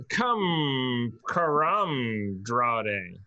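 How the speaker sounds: Karamdrade very slowly stretched out, syllable by syllable; sounding out Karamdrade. Real, not acted. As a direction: feel your way slowly through "Karamdrade". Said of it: "I would love to, uh, see a pronunciation".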